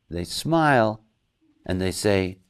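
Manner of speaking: ordinary delivery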